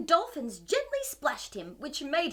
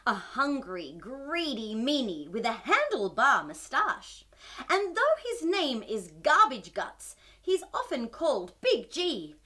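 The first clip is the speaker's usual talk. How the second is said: put-on slow, lower read-aloud for kids; storybook narration; 5 semitones lower than usual. A narrator's reading. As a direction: read it like a bedtime tale. Reads picture book to children.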